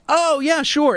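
high sarcastic "sure". Real, not acted. Sarcastic agreement to bold claim.

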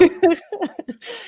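bright high peal of laughter. Real, not acted. Laughing at story.

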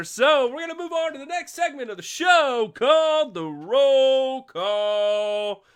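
segment name belted out like a show host; announcer call. Acted.

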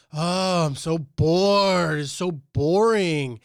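put-on slow, drawn-out whine of a bored child; whiny bored kid. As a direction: drag it out like a sulky little girl. Daughter whining while cleaning up.

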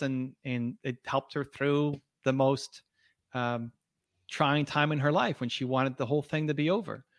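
normal talking voice

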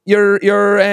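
ordinary talk, nothing marked

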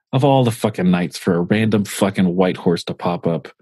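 ordinary delivery

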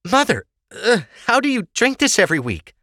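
high, strained after a burning sip; choking on vodka. A narrator's reading. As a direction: speak through the burn. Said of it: "Ava choked stiffly at its burn".

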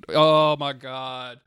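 loud, long drawn-out exclamation; overwhelmed. Real, not acted. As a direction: groan it out slowly, overwhelmed. Overwhelmed imagining the adaptation.

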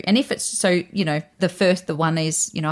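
ordinary talk, nothing marked

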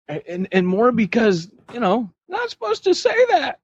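high giggly voice; laughing. Real, not acted. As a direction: laugh through it. Still giggling about the name.

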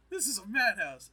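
loud, high exclamation; mock outrage. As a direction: cry out in comic disbelief. Vader's outraged thought in retelling.

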